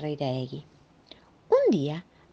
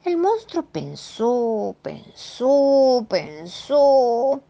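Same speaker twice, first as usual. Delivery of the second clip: slow, each "pensó" a little higher; drawn-out storytelling; 7 semitones higher, much slower than usual. A narrator's reading. Narrator drawing out the monster's slow thinking, slow and rising. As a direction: stretch it out, building suspense.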